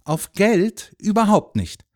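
emphatic, high-pitched disdain; contemptuous. A narrator's reading. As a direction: dismiss it with scorn. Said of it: "sagte Jürgen verächtlich".

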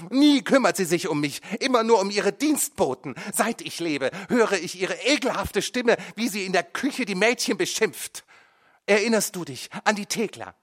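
high, loud, bitter accusation; furious. A narrator's reading. Son rages at his mother. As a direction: let years of anger out.

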